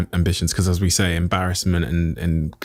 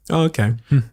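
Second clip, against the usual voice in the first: higher-pitched smirky retort; 7 semitones higher than usual. Retold or imitated. Housemates reacting to his plans, smirky. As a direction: mimic a smirky, doubting reply. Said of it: "like, smirky".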